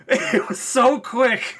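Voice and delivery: high, drawn-out words through real laughter; laughing. Real, not acted. Fan delighted by the quick joke, laughing. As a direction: say it slowly, cracking up.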